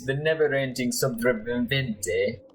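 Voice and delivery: lilting put-on Spanish accent; hammy Spanish-accented street survivor; Eduardo Carnella. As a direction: pitch your services in a Spanish accent. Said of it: "my horrible accent".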